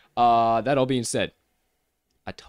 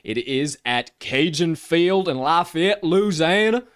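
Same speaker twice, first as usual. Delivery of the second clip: put-on drawn-out, raised Southern accent; 6 semitones higher, 5 dB louder, slower than usual. Host announcing a Louisiana game, Southern accent. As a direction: lean into a playful Southern drawl, louder on the place name. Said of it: "my Southern accent has now come out".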